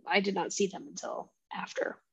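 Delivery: hushed, almost unvoiced admission; whispered aside to a friend. Real, not acted. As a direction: drop to a whisper for the confession. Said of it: "confessional aside".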